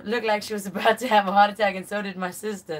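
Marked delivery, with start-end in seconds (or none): none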